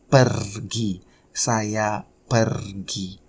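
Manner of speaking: slow "pergi" with a drawn-out trill; rolled R. Shown as a demonstration. Teacher modelling "I go" for learners, slow with a rolled R in "pergi". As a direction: stretch the R in "pergi" before "gi". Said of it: "challenging to pronounce the R".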